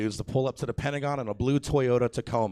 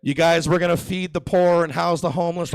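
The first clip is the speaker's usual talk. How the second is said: loud, raised politician's pledge; mock campaign promise; 5 semitones higher, 7 dB louder than usual. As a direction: proclaim it like a candidate at a rally. Politician's public promise before greedy aside.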